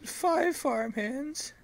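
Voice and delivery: put-on high, slow strange old farmer; odd farmer voice. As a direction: drag it out, eerie and odd.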